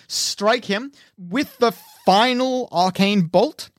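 ordinary talk, nothing marked

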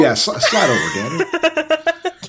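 loud laughing call, voice jumping up on the name; laughing shout. Acted. Squeezing into the car, shouts to Daniel while laughing. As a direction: laugh while urging him to slide.

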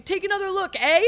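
high-pitched mock protest; imitating an indignant player; imitated freak-out. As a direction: pretend to freak out at a ref. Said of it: "freaking out and saying".

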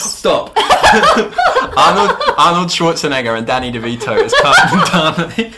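giggling delivery of a silly joke; laughing. Real, not acted. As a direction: blurt the casting idea through a laugh. Laughing at her own casting joke.

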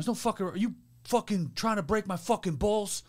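high, incredulous mock mobster rant; Sopranos tough guy. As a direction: imitate an outraged New Jersey wiseguy. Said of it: "straight out of The Sopranos".

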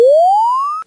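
huge high laugh, loud; loud burst of laughter. Real, not acted. Loud burst at yelled insult.

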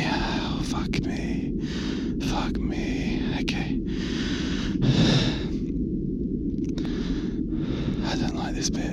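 hushed, frightened whispering; scared whisper. Real, not acted. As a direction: whisper it, nervous and tense. Nervous in eerie spot.

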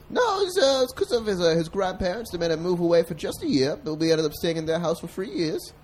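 high-pitched persona telling his story; chatty high character voice; high alter ego voice. Pretend. Alter ego narrates [name]'s life.

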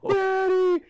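put-on very high, loud wailing child; a hammed-up toddler wail; bawling toddler. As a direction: wail for daddy like an abandoned toddler. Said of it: "the kid just starts bawling".

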